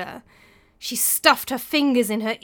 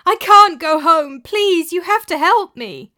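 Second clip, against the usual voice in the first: high, loud, slow begging; desperate pleading; 8 semitones higher, 11 dB louder than usual. Acted. Terrified widow begging the monks for help, high and loud. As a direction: beg them to let you in.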